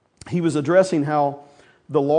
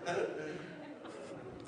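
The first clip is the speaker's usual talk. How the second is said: laughing; 22 dB quieter than usual. Real, not acted. Laughing after the dog imitation.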